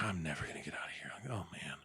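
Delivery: fast, soft whisper; whispered despair. Retold or imitated. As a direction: whisper it hopelessly. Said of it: "he's just like".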